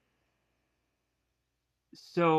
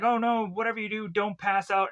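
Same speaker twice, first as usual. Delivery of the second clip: fast, high-pitched pleading; lightly acted urgent plea; mock alarm; 6 semitones higher than usual. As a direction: hurry it out in imitated worry. Emily urges him to stay awake.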